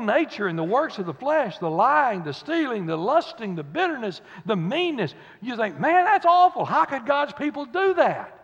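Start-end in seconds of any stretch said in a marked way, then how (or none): 5.8-8.3 high, loud shocked exclamation; a hammed-up gasp of disbelief; mock shock. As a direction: gasp it out like a scandalized churchgoer. Mock shocked churchgoer quote.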